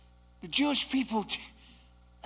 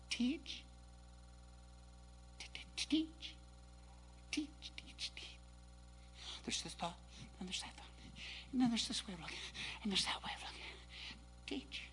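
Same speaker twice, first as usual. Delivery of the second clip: quiet teaching voice; 2 semitones higher, 14 dB quieter than usual. Shown as a demonstration. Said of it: "No. Preach!".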